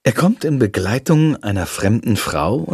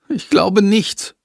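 voiced boy; 9 semitones higher, slower than usual. Boy's resigned answer.

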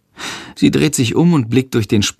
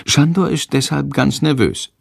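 ordinary delivery, nothing marked

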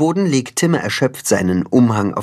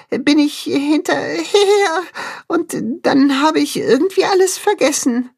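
very high, tearful little animal voice; scared little Tacho, sheepish. A narrator's reading. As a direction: confess haltingly in a high, whimpering voice.

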